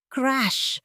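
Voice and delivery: ordinary delivery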